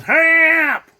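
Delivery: high, loud mock yell of "Hurry up!"; Phil Silvers shout. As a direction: bark it like the TV sergeant. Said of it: "Phil Silvers says".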